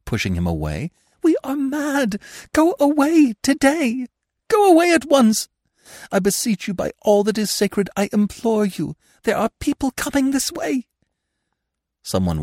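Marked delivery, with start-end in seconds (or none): 1.2-10.9 high, loud, desperate beseeching; frantic pleading. A narrator's reading. Anna to Gurov on the stairs, frantic high pleading. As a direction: beg him to leave at once.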